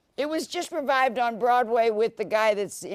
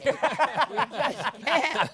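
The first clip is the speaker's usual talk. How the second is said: laughing; 4 semitones higher, much slower than usual. Real, not acted. Laughing goodbye banter.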